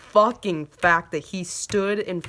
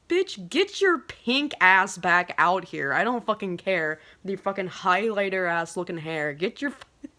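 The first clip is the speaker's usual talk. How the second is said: mock indignant demand. Fan to departing singer, mock indignant demand. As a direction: play at ordering him back, indignantly.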